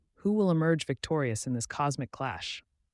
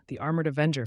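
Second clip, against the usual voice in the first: plain reading voice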